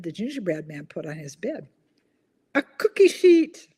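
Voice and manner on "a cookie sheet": loud, high, slow reveal; big punchline. Real, not acted. Host revealing the punchline to viewers, loud and slow. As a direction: land the pun with a flourish.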